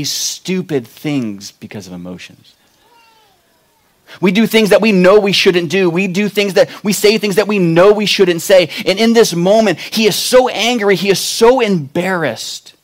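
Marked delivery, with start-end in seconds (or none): 4.2-12.7 preaching at full force. Acted.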